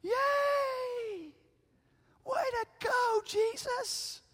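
very high, squealed pretend cheer; high-pitched make-believe celebration; mock crowd cheer. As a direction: cheer like a thrilled crowd, hammed-up. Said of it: "what do you think the reaction".

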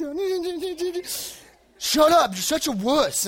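hammed-up bossy big brother; mocking the brother. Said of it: "He's like".

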